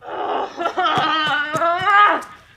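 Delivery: loud, ringing mischievous cackle; impish laugh. Acted. Puck enters the wood alone, loud impish cackle. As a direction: burst in laughing like a prankster sprite.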